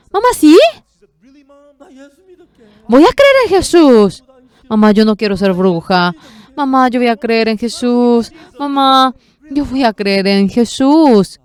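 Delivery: high, loud, panicked begging; frightened pleading. Retold or imitated. Frightened daughter begging her mother not to become a witch, panicked plea. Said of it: "Mison se asustó y dijo".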